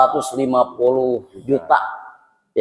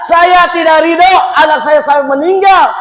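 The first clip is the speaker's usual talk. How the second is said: high, loud shout of grievance; hammed-up aggrieved parent shouting; acted angry refusal; 13 semitones higher, 5 dB louder than usual. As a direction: refuse forgiveness in a raised, high voice. Bereaved parent refusing forgiveness, acted.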